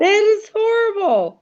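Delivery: high-pitched appalled reaction; real horror. Real, not acted. Listener reacting to the meatloaf story, real horrified disgust. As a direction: squeal it, truly grossed out.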